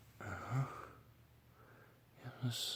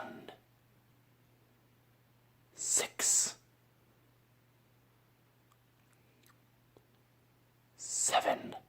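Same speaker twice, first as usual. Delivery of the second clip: whisper in left ear; 6 dB louder, much slower than usual. Shown as a demonstration. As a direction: close whisper in one ear. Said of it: "I'm gonna whisper to you, into your left ear".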